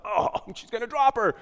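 high, loud panicked laugh; laughing alarm as the baby is whisked away. Retold or imitated. Panicked thought at nurse grabbing baby.

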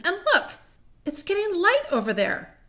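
high-pitched, thrilled discovery; excited wonder. Acted. Susan points the others to the light, excited. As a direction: point it out in amazement.

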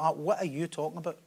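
low, soft bemused aside; puzzled inward aside. Acted. Churchgoer baffled by everyone's learned prayers, puzzled inward aside. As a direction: say it softly, as if only to yourself. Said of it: "then you're like".

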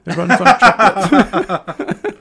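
put-on high, loud, silly Swiss accent; mock Swiss accent. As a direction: ham up a Swiss chocolatier's accent. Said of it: "I'm done with the Swiss chocolatier shit".

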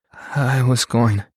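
slow, slightly quivering delivery; a touch of a quiver, spoken slowly. A narrator's reading. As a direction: draw it out with a mild tremble. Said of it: "said in a quivering voice".

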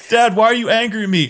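put-on high, loud whining child voice; whiny kid. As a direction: plead like a hurt little kid. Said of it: "He's like".

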